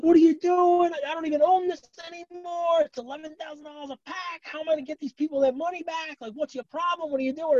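high-pitched frantic outrage, imitating a friend; mock freak-out. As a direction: play the panicked guy whose box just got smashed. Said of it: "he flips out".

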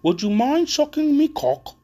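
a father's over-the-top squeaky British accent impression, slow and loud; bad British impression. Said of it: "his British impression is".

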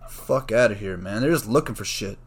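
usual speaking voice

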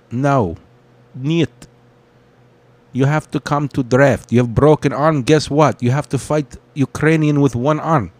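lightly put-on Russian accent, broken English; a Russian soldier in a hint of a Russian accent; mock Russian accent. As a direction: play a boastful Russian named Boris, hammed up. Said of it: "Boris. That was Boris".

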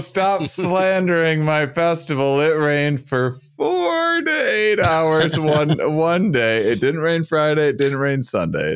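high, slow, wounded protest; mock indignation. Festival host defending his event to the guest, high slow mock indignation.

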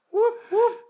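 high, loud celebratory whoop. Real, not acted. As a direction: cheer it out high. Celebrating filming news.

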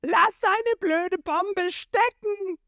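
very high, drawn-out shout; furious yell. A narrator's reading. Hero storming at the villain, high yell. Ruffy charges in yelling.

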